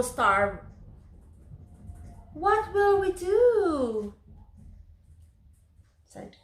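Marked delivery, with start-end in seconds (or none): 2.3-4.1 slow, high worried question; worried child. Acted. Gretel asking anxiously about starving. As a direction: ask it like a scared little girl. Frightened child asks in story.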